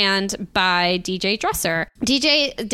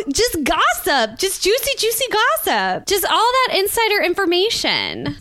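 excited; 11 semitones higher than usual. Real, not acted. Gushing about juicy gossip.

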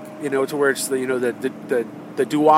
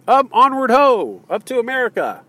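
mock rallying cry; 8 semitones higher, 4 dB louder than usual. Mock caravan leader urging crowd north, high cheer. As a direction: lead the march in jest.

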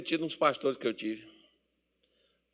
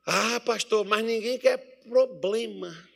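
lightly mimicked congregant, pitched higher, slowing down; complaining congregant; 7 semitones higher, slower than usual. Church member objecting to the pastor, mock complaining. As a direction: imitate a member's protest, drawing out the end.

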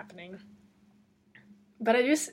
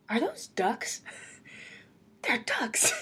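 surprised at ducks; 3 semitones higher, slower than usual. Retold or imitated. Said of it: "I said out loud to myself".

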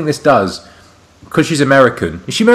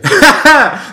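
high burst of laughter; big laugh; 14 semitones higher, 5 dB louder than usual. Real, not acted. Laughing at his friend's "ain't". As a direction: laugh out loud at the joke.

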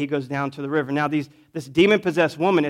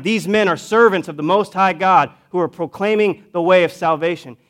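higher, louder imitated cry; retold yelling; 4 semitones higher, 7 dB louder than usual. Demon-possessed woman proclaiming behind Paul, imitated yell. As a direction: call it out emphatically as a retold yell. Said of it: "she's yelling out".

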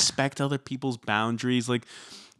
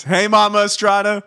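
loud, raised-pitch greeting; playful call-out; 8 semitones higher, 6 dB louder than usual. Pretend. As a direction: holler a hello to a friend's mom. Calling out to co-host's listening mom.

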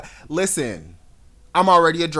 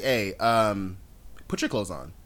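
polite; 7 semitones lower, 6 dB quieter, slower than usual. Retold or imitated. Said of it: "I didn't curse".

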